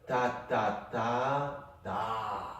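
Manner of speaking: ta ta ta ta clapped out in rhythm; rhythmic syllables, short short long longer; rhythm demo. Shown as a demonstration. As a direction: sound out the rhythm for the student. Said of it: "I'll do it again so you can hear it".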